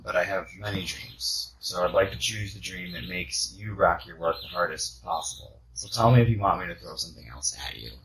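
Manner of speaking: ordinary talk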